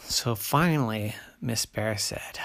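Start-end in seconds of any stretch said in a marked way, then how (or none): none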